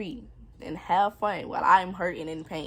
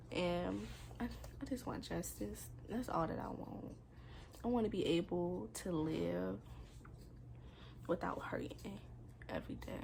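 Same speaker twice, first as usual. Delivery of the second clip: soft, near-whispered sign-off; hushed closing; 10 dB quieter than usual. Real, not acted. Asking viewers to share her story, soft and drained. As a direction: barely voice it, drained. Closes video softly.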